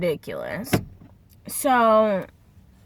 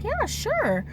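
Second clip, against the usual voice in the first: high-pitched, easygoing yes; imitated agreeable manager; 13 semitones higher than usual. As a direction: lift the voice high as the obliging manager. Voices manager agreeing to talk.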